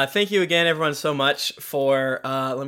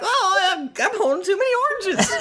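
much higher, silly flustered voice; squeaky flustered Toby juggling oranges; high cartoonish panic; comic character voice; faster than usual. GM voicing Toby's entrance.

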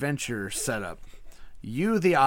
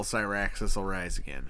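low, worn-out, soft priest; like a man put through hell; exhausted priest; 5 semitones lower, 4 dB quieter than usual. Shown as a demonstration. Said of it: "normal priest dude that just got put through hell".

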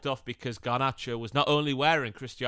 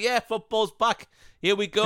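excited fan; 8 semitones higher, 6 dB louder than usual. Retold or imitated. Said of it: "it's like".

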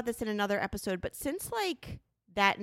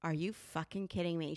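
quiet disbelief; 4 semitones lower, 8 dB quieter than usual. Retold or imitated. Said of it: "And she's like".

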